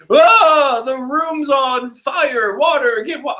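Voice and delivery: a rough try at Brook, pitched high; high, slowed panicky skeleton voice; a clumsy attempt at Brook panicking; Brook's voice. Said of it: "I need to work on Brook's voice".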